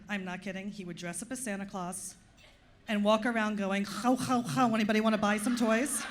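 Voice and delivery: usual speaking voice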